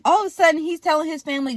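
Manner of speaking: ordinary delivery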